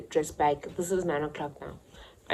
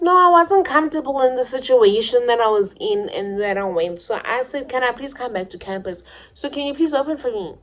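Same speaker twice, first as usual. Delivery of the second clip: high, louder pleading voice; pleading schoolgirl; 7 semitones higher, 8 dB louder than usual. Retold or imitated. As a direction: beg to be let back in. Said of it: "Then I'm like".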